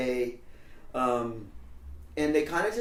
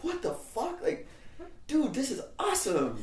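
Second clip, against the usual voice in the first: high, laughing amazement; excited teens; 11 semitones higher, 3 dB quieter than usual. Retold or imitated. Teen runaways thrilled by Lydia's story. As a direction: squeal with delight. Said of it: "we're like".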